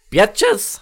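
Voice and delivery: excited yell. Real, not acted. Excited yell after success.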